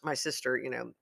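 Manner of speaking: ordinary talk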